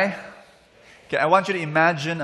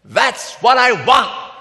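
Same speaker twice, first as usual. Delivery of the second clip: high, drawn-out shout of a customer; acted shout; 8 semitones higher, 11 dB louder, slower than usual. Man at the table shouts at the doubting waiter, insistent loud shout. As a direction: bellow it slowly, word by word. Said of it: "the man shouts".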